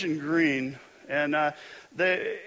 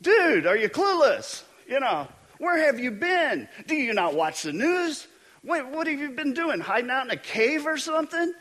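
higher and louder, disbelieving; incredulous mock scolding; 9 semitones higher, 4 dB louder than usual. Cleophas scolds the stranger on the road, incredulous. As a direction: drive home how clueless he seems. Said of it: "asked him".

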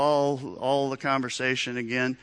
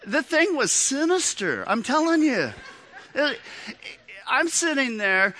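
mock dramatic outrage; 9 semitones higher than usual. Mock horror about Elmo.

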